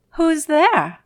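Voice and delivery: put-on high, loud old woman calling; old grandmother. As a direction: call out high like a frail granny. Grandmother answers the knock.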